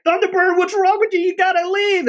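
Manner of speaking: very high, loud hammed-up yell; mock alarmed shout. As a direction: shriek at him to get off. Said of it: "and they're like".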